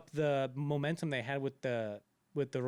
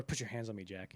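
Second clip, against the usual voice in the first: softer, lower mock love line; imitated intimate murmur; 5 semitones lower, 7 dB quieter than usual. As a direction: let it sink away like a film lover. Retells Rose in the love scene.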